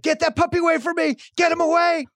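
high, shrill-free mock shriek of an angry wife; wife in a meltdown over the puppy, mock screaming. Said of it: "and then it's like".

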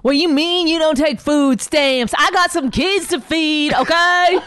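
high, loud mock outrage; hammed-up sassy complaint; indignant lady customer. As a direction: argue with the store clerk, affronted. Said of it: "do lady at a".

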